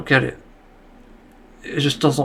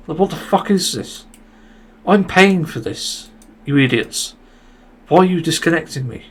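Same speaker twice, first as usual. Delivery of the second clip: higher and louder, yelling at the idiots; furious rant, slowing on I'm paying for this; angry outburst; 4 semitones higher, 5 dB louder than usual. Real, not acted. A gamer yelling at Ubisoft over a disconnect, angry and loud.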